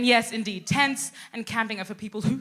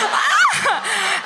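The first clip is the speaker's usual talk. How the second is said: high giggle; 11 semitones higher, 6 dB louder than usual. Real, not acted. Laughing at her own reaction.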